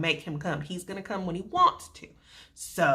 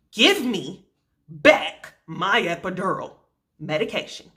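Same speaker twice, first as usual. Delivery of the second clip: frustrated demand; 5 semitones higher than usual. Retold or imitated. Patient in labor confronting the nurse, frustrated demand. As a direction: demand it back, fed up.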